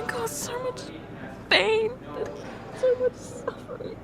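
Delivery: high, soft, tear-choked apology; weeping. Acted. Apologizing to her partner for the danger, weeping. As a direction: barely get the words out through weeping.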